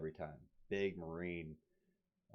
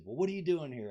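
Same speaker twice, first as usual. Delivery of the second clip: higher, louder recruiter imitation; 11 semitones higher, 8 dB louder than usual. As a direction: press him like a gruff recruiter. Recruiter asks again.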